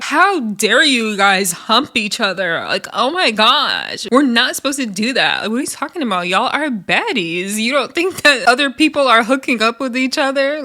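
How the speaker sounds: hammed-up "how dare you" scolding; mock outrage. Recapper exaggerates Rolly's funny reaction to the hookups, mock-scandalized. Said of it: "Her reaction was just like".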